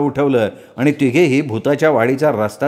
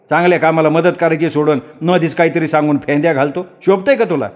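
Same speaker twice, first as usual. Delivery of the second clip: cross villager, pitched higher; rebuking villager; 4 semitones higher than usual. Acted. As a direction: cut him short and scold him. (उंच आवाजात फटकारणारा गावकरी)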